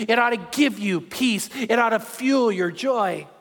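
ordinary talk, nothing marked

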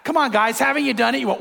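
ordinary talk, nothing marked

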